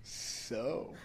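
soft, halting, awkward check-in; hesitate, then lift the question tentatively. Retold or imitated. Truck owner after an awkward stare with the driver, retold awkwardness. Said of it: "'cause I got too awkward".

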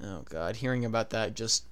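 low, soft, deflated groan of dismay; heartbroken. Real, not acted. Fan hearing about a deepfaked young Mark Hamill, quietly heartbroken. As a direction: say it quietly, well down in pitch, as if wounded. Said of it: "just broke my heart".

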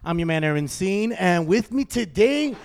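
loud, upbeat show opener; show host intro. Acted. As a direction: hype up the show intro, drawing out names. Podcast host opening the show.

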